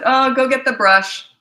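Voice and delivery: loud, slow cheerful command; playful command. Retold or imitated. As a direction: call them over playfully. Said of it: "I would have said".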